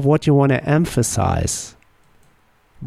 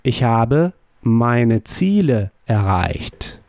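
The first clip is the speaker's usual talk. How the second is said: slow, clear German model sentence; slow model answer; slower than usual. Shown as a demonstration. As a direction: say it slowly for learners to repeat. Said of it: "How would you say".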